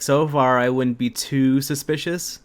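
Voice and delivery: ordinary delivery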